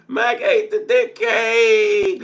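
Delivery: amused slow repeat; laughing. Real, not acted. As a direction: draw it out through laughter. Laughing at chat.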